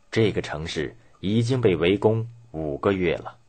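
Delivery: plain reading voice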